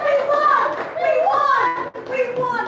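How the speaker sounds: squealing we-won chant; excited cheering. Acted. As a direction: celebrate like you just won. Team celebrates winning.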